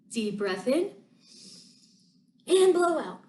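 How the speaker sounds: soft, calm breathing cues; guided breathing. Acted. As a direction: breathe in and blow out softly. Leads breathing exercise.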